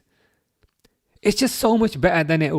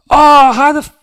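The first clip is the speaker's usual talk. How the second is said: loud, much higher cry of frustration; excited outburst; 14 semitones higher, 11 dB louder than usual. Real, not acted. Watching Wirtz shoot over, loud high outburst. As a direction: blurt it as the shot flies. Shot goes over, reacting live.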